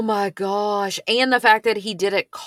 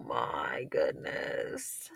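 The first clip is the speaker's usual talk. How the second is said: stunned whisper; 15 dB quieter, much slower than usual. Real, not acted. Stunned reaction to victim ignored.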